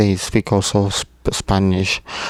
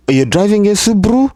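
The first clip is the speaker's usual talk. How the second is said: much higher, fast, rising "Subaru?"; surprised question; 12 semitones higher, 3 dB louder, faster than usual. Retold or imitated. Newcomer amazed at the car name, high surprised. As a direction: squeak it out in disbelief. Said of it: "you might say".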